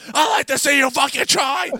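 high, very loud imitated angry yell; furious shout. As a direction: explode at the caller, mimicking rage. Enraged man's retort before slamming phone.

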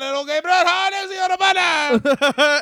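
high, strained comic shout; Pat Patterson. Pretend. Comic pitching a wrestling match, in a high, shouted caricature. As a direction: build to a squealing mock French-accented holler. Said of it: "that's what Pat Patterson sounds like".

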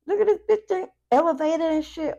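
high squeaky mock gasp; mock jealous ex. Reader imitating the ex watching the client glow up, high squeak. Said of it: "they looking at you like".

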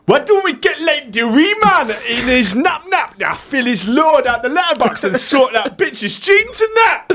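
very high put-on character voice; Looney Tube. Sidekick pitches his great idea to the squad, silly put-on voice. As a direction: have a rough crack at a high, silly, accented voice. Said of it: "Can't really do the voice, but I'll have a crack".

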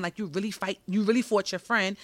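ordinary talk, nothing marked

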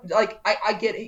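normal talking voice

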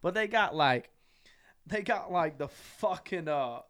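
usual speaking voice

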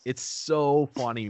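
normal talking voice

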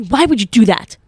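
loud, exasperated mock outburst; violent demand. Roommate confronting her after the yelling, mock-angry demand. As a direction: throw it out hard, imitating an appalled roommate. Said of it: "Like, so violently".

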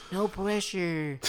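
put-on high, slow, whiny old geezer; old man voice. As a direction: drag it out like a tired old man. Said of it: "he'll just be like".

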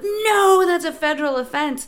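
high, loud mock-horrified objection; alarmed refusal. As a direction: cry it out like a scandalized rule-keeper. Others forbid mailbox flyers, acted.

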